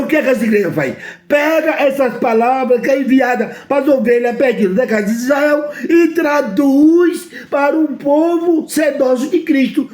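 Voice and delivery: usual speaking voice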